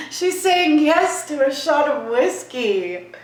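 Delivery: delighted amazed repeat. Real, not acted. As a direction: savour the disbelief. Repeats the whiskey answer, amazed.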